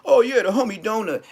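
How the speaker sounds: mock his fake-friendly tone way up high; mocking high sing-song. Rapper mocking Wack's sudden friendliness with a mock high sing-song. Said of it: "Wack using his name".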